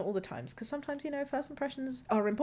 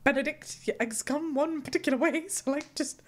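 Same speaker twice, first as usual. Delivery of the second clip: mock indignant self; 4 semitones higher, 3 dB louder than usual. Acting her past reasoning.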